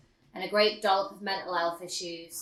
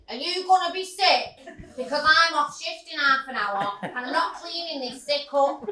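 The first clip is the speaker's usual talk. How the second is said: higher, louder Manc shout; loud Manc accent; 6 semitones higher, 4 dB louder than usual. Retold or imitated. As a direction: bark it like a fed-up Manchester nurse. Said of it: "shouts in a loud Manc accent".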